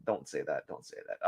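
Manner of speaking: unvoiced whisper to herself. Real, not acted. Scolding herself for the joke in a whisper. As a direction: hush it under your breath. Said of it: "whispers to herself".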